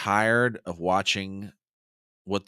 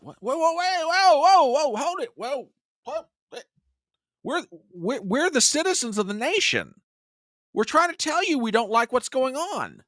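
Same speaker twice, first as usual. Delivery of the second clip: much higher, alarmed whoa, whoa, hold it; high protesting citizens. Pretend. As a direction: squeal in protest as the wronged citizens.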